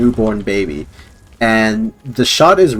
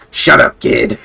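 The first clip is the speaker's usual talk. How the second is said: rough mock snap at a child; put-on villain voice, cutting the kid off; inner criminal; 3 semitones higher, slower than usual. Inner criminal silencing the kid, harsh. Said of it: "the guy going".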